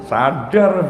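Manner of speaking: ordinary talk, nothing marked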